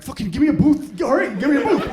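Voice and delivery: loud, high, harried outburst; frantic demand. Acted. Parent wrestling toddler begs host for booth, frantic. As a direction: blurt it like you're losing your grip.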